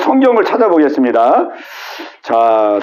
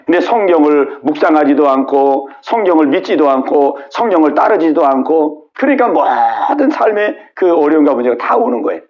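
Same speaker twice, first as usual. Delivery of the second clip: ordinary delivery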